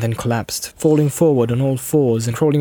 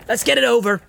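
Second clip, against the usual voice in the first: impatient Sam; 11 semitones higher, faster than usual. A narrator's reading.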